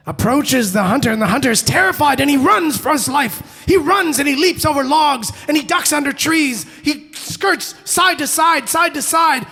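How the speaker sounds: loud, high, urgent storytelling; frantic chase narration. A narrator's reading. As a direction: drive the chase along at full voice.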